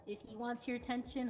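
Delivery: ordinary delivery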